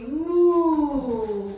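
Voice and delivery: long, loud demonstration moo; cow moo. Shown as a demonstration. Yoga teacher going into cow pose, long moo. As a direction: draw out a playful cow moo. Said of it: "into cow and say".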